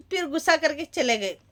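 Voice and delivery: ordinary talk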